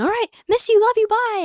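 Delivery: high, loud, sing-song brush-off; mock breezy goodbye. To an ex-friend after lunch, a high loud breezy goodbye. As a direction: chirp it out like a fake-cheerful farewell.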